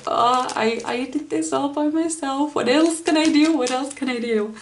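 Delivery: acted giddy self-discovery; childlike happiness. Voicing the feeling of doing it all alone, childlike happiness. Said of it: "this childlike happiness".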